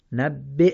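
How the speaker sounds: نبع read with the hamza under ya silent; teacher models نبع without any ی sound; correct Quran reading. Shown as a demonstration. Teacher reading نبع correctly for students. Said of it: "دقت کنیم نبیع نخونیم".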